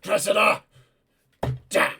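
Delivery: high, loud anguished call; shouting. Acted. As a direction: cry out for the fallen knight. Lord shouts in battle.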